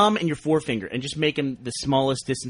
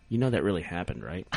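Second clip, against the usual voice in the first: put-on older brother; 9 semitones lower, 8 dB quieter than usual. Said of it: "my older brother, turns to me and goes".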